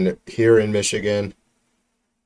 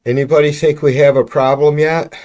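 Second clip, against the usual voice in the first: sarcastic rhetorical jab; 6 semitones higher, 6 dB louder than usual. Real, not acted. Sarcastic rhetorical question to chat.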